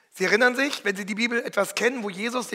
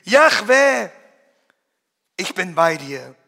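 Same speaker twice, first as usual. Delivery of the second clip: ringing call; 10 dB louder, slower than usual. Acted. Preacher proclaiming God's name, loud ringing call. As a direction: call it out with awe.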